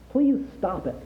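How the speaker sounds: high-pitched plea breaking out. A narrator's reading. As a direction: burst in with a pained plea. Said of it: "breaking out".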